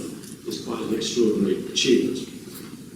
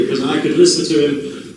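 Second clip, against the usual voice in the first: ordinary delivery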